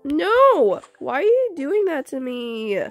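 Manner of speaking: usual speaking voice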